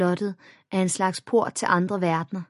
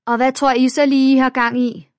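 shouted; 7 semitones higher, 9 dB louder than usual. A narrator's reading. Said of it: "råbte en stemme".

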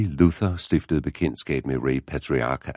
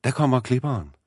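put-on high, piercing boy calling out; skinger drengestemme; 10 semitones higher, faster than usual. A narrator's reading. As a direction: call it high above the narration, excitedly. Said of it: "råbte han med skinger stemme". (skinger, ivrigt drengeråb)

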